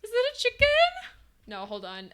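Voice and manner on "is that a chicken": high, loud squeaky question; mock incredulous. Streamer mocks the penguin's chicken disguise, high incredulous squeal. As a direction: squeal it in disbelief.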